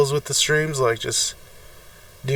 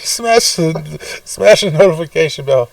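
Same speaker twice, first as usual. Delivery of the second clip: louder, higher fake sobbing plea; fake crying plea; 6 semitones higher, 7 dB louder than usual. Begging viewers to subscribe through fake tears, louder. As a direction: beg through pretend tears. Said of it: "I'm crying".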